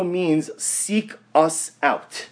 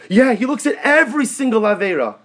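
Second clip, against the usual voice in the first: higher, louder imitation of an excited child; eager young daughter; 6 semitones higher, 5 dB louder than usual. Youngest daughter telling Tati what she learned, eager child imitation. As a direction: imitate a child proudly reciting a lesson.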